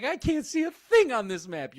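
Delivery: high, loud imitation of a frustrated woman; exasperated mock complaint. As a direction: whine it up high, mocking her. Mocks a movie character's complaint.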